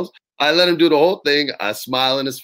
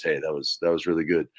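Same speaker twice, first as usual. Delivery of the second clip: lower, understated mumbled compliment; grudging praise; 7 semitones lower, 5 dB quieter than usual. Retold or imitated. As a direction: imitate a man barely mustering praise. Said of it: "it was the best he could muster".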